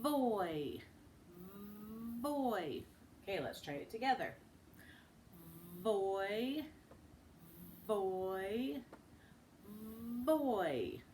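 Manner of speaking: voy with bitten lip, buzzing v; voiced v into oy; blended sound. Shown as a demonstration. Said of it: "Look at my lips".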